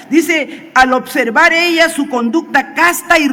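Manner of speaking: loud, high-pitched sermon delivery; forceful preaching. Real, not acted. Preacher exhorting the wives of the congregation, forceful preaching. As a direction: preach it out at full force, emphatic phrases.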